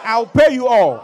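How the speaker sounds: high, loud, slow plea; shouted promise to pay; desperate promise. Acted. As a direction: beg him to wait. Debtor begs, congregation repeats.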